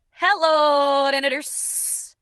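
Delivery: high, loud hello, then a slow, drawn-out janitors; sitcom shout. Acted. The janitor greets the hallway a third time with a shout. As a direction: stretch the last word out slowly like a catchphrase.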